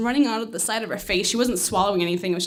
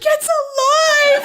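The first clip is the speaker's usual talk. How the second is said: very high, loud, drawn-out cry; hammed-up mad-scientist yell, stretched long; exultant shout; 9 dB louder, much slower than usual. She yells to husband that the kitty breathes, exultant shout.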